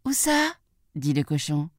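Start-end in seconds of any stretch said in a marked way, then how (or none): 0.1-0.5 high piglet question; little pig. Acted. Little pig asks the wolf where, high-voiced.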